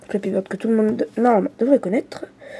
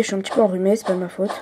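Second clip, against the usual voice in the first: usual speaking voice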